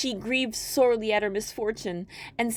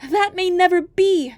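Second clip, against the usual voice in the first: high, louder lament; young girl's high wail; despairing maiden; 10 semitones higher, 8 dB louder than usual. Acted. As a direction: cry out your hopelessness. Maiden's fearful thought.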